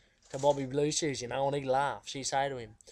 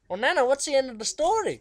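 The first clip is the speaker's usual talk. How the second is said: put-on higher, louder curious boy; young Bobby asking; 10 semitones higher, 6 dB louder than usual. Bobby asks Nana how the story ends, high and eager. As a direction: ask like an eager kid. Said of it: "he'd say".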